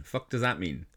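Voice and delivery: normal talking voice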